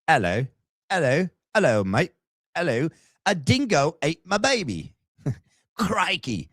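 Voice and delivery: high-pitched mock Aussie; attempted Australian accent. Practising stock Aussie phrases in an amateur Australian accent. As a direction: ham up a shaky grasp of an Australian accent. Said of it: "I'll work on my Australian accent".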